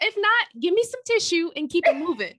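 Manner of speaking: high, loud, quick send-off; mock dismissal. Friend waves away critics of crying, mock sassy. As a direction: playfully shoo them along.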